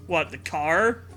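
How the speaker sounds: ordinary delivery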